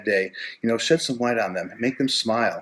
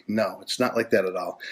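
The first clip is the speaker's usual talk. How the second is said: usual speaking voice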